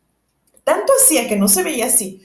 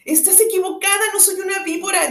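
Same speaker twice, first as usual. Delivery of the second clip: angry; 9 semitones higher than usual. A narrator's reading. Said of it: "dijo Alicia encolerizada".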